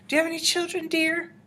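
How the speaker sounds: put-on very high, sugary old-lady voice; sweetly needling. A narrator's reading. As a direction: ask it in a dainty high pitch. Grandmother sweetly needles the witch.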